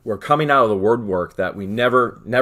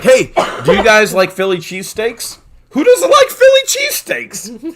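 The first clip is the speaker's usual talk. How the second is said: Kevin's offer; 10 semitones higher, 6 dB louder than usual. Retold or imitated. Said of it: "and he's like".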